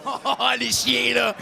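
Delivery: slow shouted call at the crowd; mock scolding shout. Comedian shouting teasingly at the audience.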